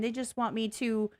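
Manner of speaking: ordinary talk, nothing marked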